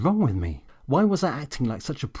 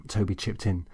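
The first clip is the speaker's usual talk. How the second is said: usual speaking voice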